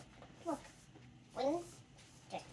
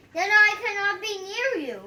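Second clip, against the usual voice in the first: loud dismay; 7 semitones higher, 13 dB louder than usual. Acted. Gerald dismayed.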